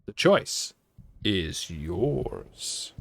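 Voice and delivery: slow, drawn-out 'the choice is yours'; game show catchphrase. Retold or imitated. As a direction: imitate a show host's catchphrase, trailing low and soft. Quotes a show catchphrase as quiz.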